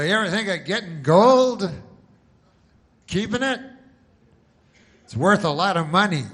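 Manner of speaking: loud, high salesman's come-on; pushy commercial pitchman. Retold or imitated. Comic mocking a TV gold salesman, loud and pushy. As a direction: sell it hard like a TV ad guy.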